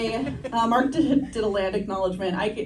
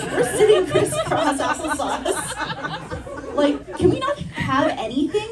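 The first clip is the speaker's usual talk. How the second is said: high, laughing indignant rant; laughing mock outrage; 9 semitones higher than usual. Real, not acted. Comedian ranting to the audience about stolen sitting style, laughing and high. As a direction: rant in outrage while cracking up.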